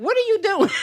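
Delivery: high, startled question; taken aback, voice shooting up; shocked. Retold or imitated. Retold shocked reaction.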